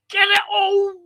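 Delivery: high, loud burst of excitement; excited yell. Real, not acted. Fan yelling as the striker shoots, high and loud. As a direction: leap up yelling at the shot. Big chance in the match.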